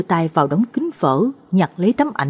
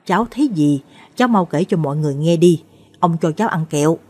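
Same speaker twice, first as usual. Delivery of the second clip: usual speaking voice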